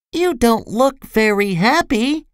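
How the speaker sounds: small, high-pitched voice; high little storybook voice; little character voice. A narrator's reading. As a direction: pitch it well up, like a tiny person. Tiny character speaks to tall one.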